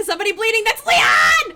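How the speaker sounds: high, loud gleeful callout; excited shout. Real, not acted. Killer shouting on finding bleeding Leon. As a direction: shout it like spotting prey. Calling out wounded survivor.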